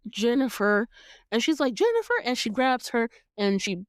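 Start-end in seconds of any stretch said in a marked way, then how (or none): 1.7-2.1 a quick high call of the name; high startled call of the name. Retold or imitated. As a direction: call her name sharply, higher. Needy calling out to Jennifer.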